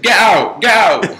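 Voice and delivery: high, loud shout to get out; shouted order. Retold or imitated. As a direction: yell at an intruder to leave. Ordering intruder out of his room.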